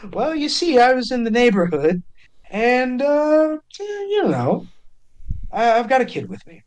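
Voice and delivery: high, evasive, laughing-edged reply; sheepish chuckling Cade. Acted. Cade deflecting Blevin's question, high and sheepish. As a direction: dodge the question with a high nervous lilt. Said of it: "Cade chuckles".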